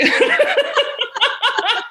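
high bursting laugh; cracking up hard; real high-pitched laughter. Real, not acted. Brother's punchline.

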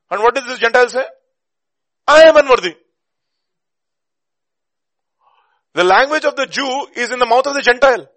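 high, loud, shouted exposition; impassioned preacher at full volume; full-force preaching. Real, not acted. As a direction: shout the argument to the whole hall.

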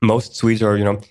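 ordinary talk

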